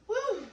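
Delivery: a high, loud whoop of joy; excited woo. Real, not acted. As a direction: cheer it out, thrilled. Excited cheer to viewers.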